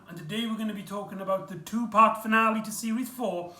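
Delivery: normal talking voice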